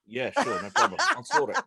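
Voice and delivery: laughing through the words; chuckling while agreeing. Real, not acted. Joking sure no problem.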